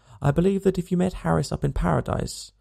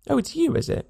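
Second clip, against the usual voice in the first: surprised recognition; 12 semitones higher than usual. Acted. Recognising Jim after the struggle.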